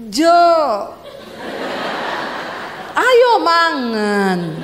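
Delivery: very slow, drawn-out Javanese falling tune; attempted Javanese intonation. Wife trying Javanese on husband, slow exaggerated falling call. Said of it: "Ya saya akan coba".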